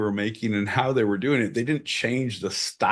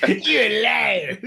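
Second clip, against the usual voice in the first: higher, slow, laughing; laughing quote; 7 semitones higher, slower than usual. Retold or imitated. Quoting Dylan to cohost, laughing. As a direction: drag it out laughing.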